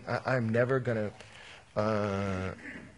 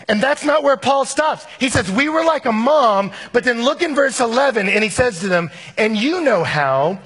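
loud, high-pitched preacher's proclamation; ringing, emphatic pulpit delivery; full-force preaching; 9 semitones higher, 11 dB louder than usual. Acted. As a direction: preach it out at the top of your voice.

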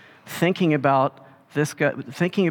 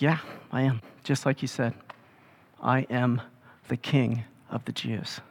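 softer, quiet, steady answer; calm and dignified; 4 semitones lower, 6 dB quieter than usual. Retold or imitated. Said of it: "very calmly, uh, with a lot of dignity".

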